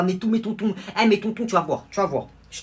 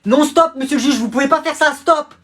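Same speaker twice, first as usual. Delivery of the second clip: shouting; 8 semitones higher, 8 dB louder than usual. Acted. Lawyer stopping the judge.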